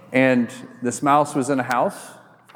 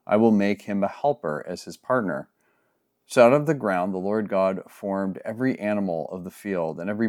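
lower, measured Bible reading voice; scripture reading; 7 semitones lower than usual. Acted. Pastor reading Genesis aloud to the congregation, solemn and measured. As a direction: read the passage with solemn care.

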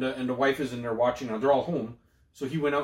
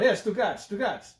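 Italian guys calling; 6 semitones higher than usual. Retold or imitated. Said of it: "they're always calling each other".

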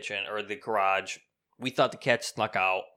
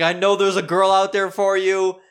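raised, projected impression of her reassurance; consoling neighbor lady; 6 semitones higher, 7 dB louder than usual. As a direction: encourage him in her high, loud voice. Lady consoling him, retold.

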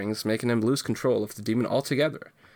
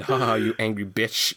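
a sneering, gloating jeer, a bit louder; mocking taunt; 4 dB louder than usual. [name] taunts Tomorrow in comic, loud mocking jeer. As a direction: rub it in like a smug villain. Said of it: "question mark?".

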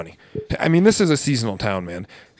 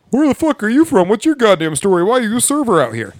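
higher, mocking tourist voice; lightly voiced rude tourist asking questions; mock nosy tourist; 6 semitones higher than usual. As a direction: parrot the pushy tourists with scorn. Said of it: "different goddamn question other than".

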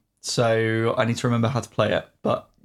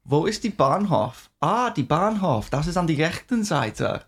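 put-on strong German accent; 4 semitones higher than usual. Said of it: "a really strong accent".

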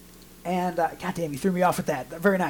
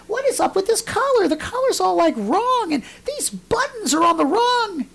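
very high, baffled comic whine; retold exasperation; 11 semitones higher than usual. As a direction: climb high as each flaw baffles you more. Retold mounting bafflement at collar.

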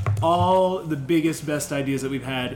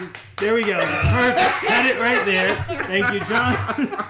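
higher, laughing through it; 5 semitones higher, 3 dB louder than usual. Real, not acted. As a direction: laugh while you say it. Laughing at the flubbed moment.

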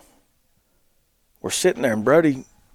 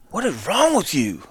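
raised, stretched-out baffled question; exasperated; 4 semitones higher than usual. Retold or imitated. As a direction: act out disbelief, pitched up. Retold exasperated question repeated.

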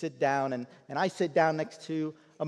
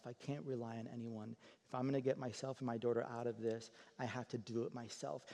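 quiet confession; 2 semitones lower, 9 dB quieter than usual. Retold or imitated. Mother's hard confession retold.

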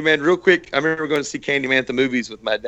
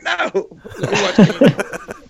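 higher-pitched protest through laughter; laughing protest; 7 semitones higher than usual. Retold or imitated. Kid refusing dad at the movies, laughing mock whine. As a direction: whine it out while cracking up.

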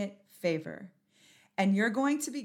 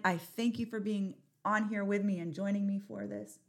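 usual speaking voice

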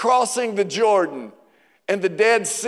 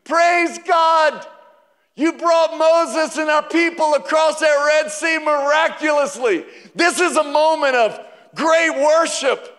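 celebrating crowd; 8 semitones higher than usual. Acted. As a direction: shout praise like a festival crowd. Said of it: "As they were celebrating".

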